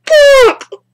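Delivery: loud, high, drawn-out cries; horrified wailing. Real, not acted. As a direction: wail in shock, stretching the words. Horrified by game scene.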